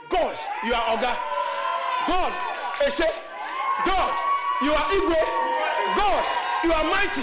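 loud, high acclamations with pauses between; shouted praise. Shown as a demonstration. Preacher showing how to praise God, shouted acclamation. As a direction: proclaim each title at full force. Demonstrates praise at full force.

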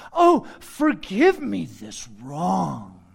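sarcastic. Retold or imitated. A preacher voicing Paul's sarcastic apology to the church. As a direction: drip with sarcasm. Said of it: "He's being sarcastic".

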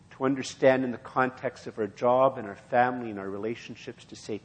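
usual speaking voice

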